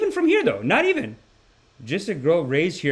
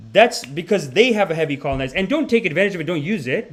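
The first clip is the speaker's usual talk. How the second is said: usual speaking voice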